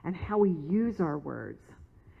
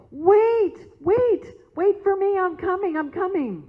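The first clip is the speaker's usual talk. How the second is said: put-on much higher and louder, a small girl calling out; little girl's voice; 12 semitones higher, 7 dB louder than usual. Young girl calling to the prayer circle to wait, high and loud. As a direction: shout after them like a child running late. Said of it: "she hears this little voice".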